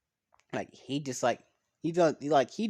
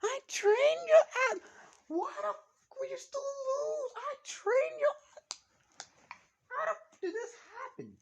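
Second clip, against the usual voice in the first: mock screeching trainer demanding how he lost; high furious coach voice; 7 dB louder than usual. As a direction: rant at the loser in a squealing high voice. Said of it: "[name] remembers his voice that he heard".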